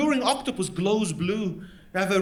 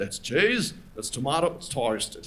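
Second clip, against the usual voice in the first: lower, slower broad Aussie ad read; Australian ad voice; 8 semitones lower, 4 dB quieter, slower than usual. Retold or imitated. McDonald's ad man selling toastie, Australian accent. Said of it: "He said".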